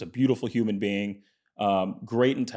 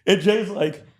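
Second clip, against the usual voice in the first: counting down, building louder; playful countdown; 8 semitones higher, 6 dB louder, slower than usual. Retold or imitated. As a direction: tease him with a rising countdown. [name] counts down in story.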